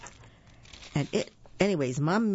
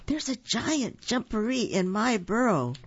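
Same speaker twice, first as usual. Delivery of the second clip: alarmed rabbit; 7 semitones higher than usual. A narrator's reading. Frightened Rabbit explains to Cat.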